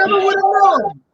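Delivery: mock astonishment. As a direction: ham up being amazed to tease him. Host teasing guest's joke, mock disbelief.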